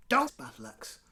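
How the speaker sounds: usual speaking voice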